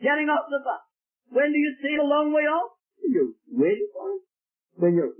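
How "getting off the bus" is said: high excited call. Retold or imitated. Neighbour at the door calling that the son is here, excited. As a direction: shout the news from the door. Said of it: "And you shout in to someone".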